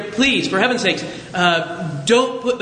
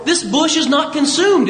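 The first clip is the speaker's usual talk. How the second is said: ordinary talk, nothing marked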